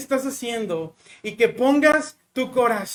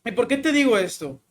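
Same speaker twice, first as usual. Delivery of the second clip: ordinary talk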